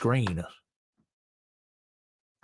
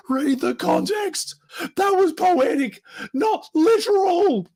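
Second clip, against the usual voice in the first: very high, loud squeaky caricature; mocked apologist retort; 7 dB louder, slower than usual. Critic parodies the typical apologist response, shrill mock protest. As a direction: shout it in a silly high voice, words stretched. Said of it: "the typical response is".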